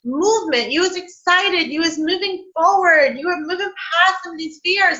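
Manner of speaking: high-pitched, animated mock scolding; incredulous energy. Energy reminding her she was moving forward, mock incredulity. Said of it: "And, and she's like".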